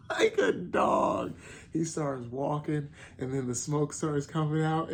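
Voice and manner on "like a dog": laughing and half-shouting the words, high. Real, not acted. Fan recapping the anime scene, laughing high and excited. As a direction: burst out laughing as you say it.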